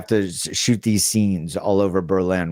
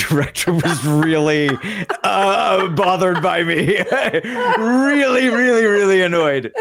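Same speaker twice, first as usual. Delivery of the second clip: laughing through the words, higher; 12 semitones higher, 4 dB louder than usual. Real, not acted. Telling his cohost about the casting director, laughing. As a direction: crack up while you say it.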